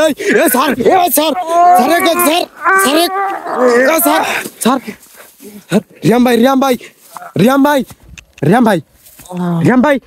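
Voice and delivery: high frantic calling; panicking gamer. Real, not acted. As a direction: call out in a panic. Frantic calling for missing friend.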